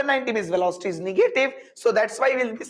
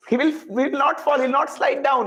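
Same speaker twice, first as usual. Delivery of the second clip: ordinary delivery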